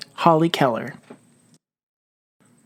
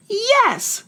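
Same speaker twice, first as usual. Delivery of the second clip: sharp, very high yes shouted up; yelling. Acted. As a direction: fling it up from the bottom of the hole. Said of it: "Mouse yelled".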